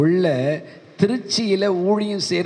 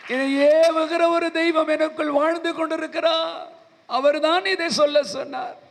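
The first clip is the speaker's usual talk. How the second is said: much higher, ringing proclamation; fervent preaching; 10 semitones higher than usual. Shown as a demonstration. The preacher repeats it to the weeping man, higher and ringing. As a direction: lift it high and proclaim ஏவுகிற like a sermon climax.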